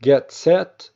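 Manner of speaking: ordinary talk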